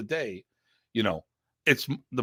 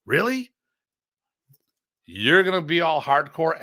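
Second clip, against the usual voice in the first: incredulous; 5 semitones higher than usual. Retold or imitated. Incredulous jab at rival.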